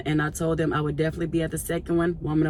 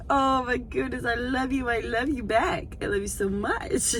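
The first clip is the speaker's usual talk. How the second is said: softly loving; 5 semitones higher, 5 dB quieter than usual. Real, not acted.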